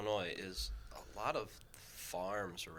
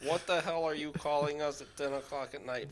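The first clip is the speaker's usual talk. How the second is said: louder, higher mock outrage from the dispatcher; mock police gruffness; 7 semitones higher, 9 dB louder than usual. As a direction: ham up an annoyed cop on the phone. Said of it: "So I'll tell you what they said".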